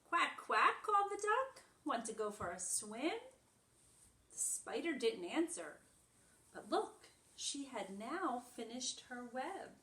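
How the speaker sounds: animated picture-book read-aloud; reading to children. Acted. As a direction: read it brightly to little ones. Reads picture book to class.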